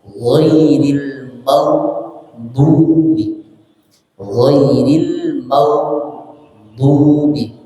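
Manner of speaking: "Ghairil maghdubi" very slow, every syllable held; "Ghairil" with lips forward, not gaping; careful ghain pronunciation. Shown as a demonstration. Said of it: "Jadi ghainnya itu untuk posisi".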